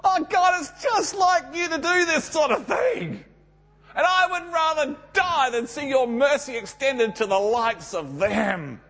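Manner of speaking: high, shouting complaint; exasperated rant at God; angry outburst. Retold or imitated. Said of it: "In other words, Jonah's saying".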